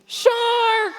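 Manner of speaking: high loud shout of "Shark!". Shown as a demonstration. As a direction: call "Shark!" out with the kids. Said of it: "Can you shout that with me?".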